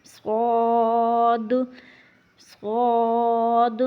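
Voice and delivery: صاد long, lips rounded, mouth full; heavy rounded صاد drawn out; rounded sad. Shown as a demonstration. Teacher models a rounded heavy صاد. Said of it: "ہونٹ کو گول کرنا ہے۔".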